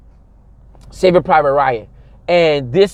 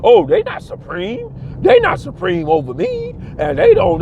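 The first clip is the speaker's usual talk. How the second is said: much higher, put-on naive mock voice; 10 semitones higher than usual. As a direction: parody the people who say they're not supreme. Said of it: "We get all caught up in the idea".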